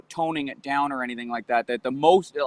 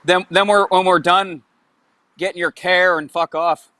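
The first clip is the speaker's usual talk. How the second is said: put-on louder Newfoundland accent saying care for car; hammed-up Newfoundlander, care for car; 2 semitones higher, 5 dB louder than usual. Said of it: "They say that's Newfoundland".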